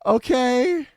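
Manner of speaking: high forced laugh, hollow and polite; fake polite laugh. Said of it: "And I was like".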